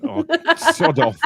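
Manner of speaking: real laughter breaking through a mock telling-off; laughing. Real, not acted. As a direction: brush him off while cracking up. Laughing dismissal of co-host.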